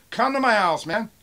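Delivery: loud, high angry challenge; real pissed-off shout. Real, not acted. Seller challenging a threatening buyer, angry shout. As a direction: shout the dare in one quick burst. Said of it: "Now I'm pissed off reading this".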